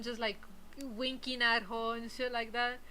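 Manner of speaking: usual speaking voice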